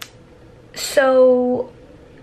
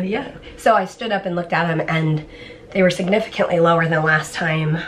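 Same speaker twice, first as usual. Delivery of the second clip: ordinary delivery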